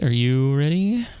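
normal talking voice

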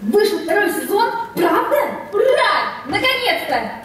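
high laughing cheer; overjoyed. Acted. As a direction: squeal with delight. Joy at second season news.

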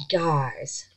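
usual speaking voice

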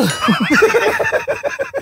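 a burst of high squealing laughter. Real, not acted.

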